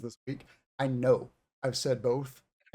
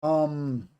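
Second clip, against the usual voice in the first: normal talking voice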